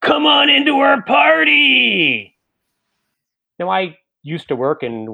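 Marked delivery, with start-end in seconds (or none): 0.0-2.3 high, slow yelled call; a teen shouting at passersby; screamed invitation. Retold or imitated. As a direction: holler it from inside the shop. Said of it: "one of them screams at us".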